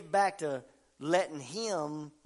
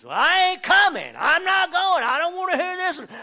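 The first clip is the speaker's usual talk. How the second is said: mock defiant refusal; 11 semitones higher, 13 dB louder than usual. Mocking churchgoers refusing to come.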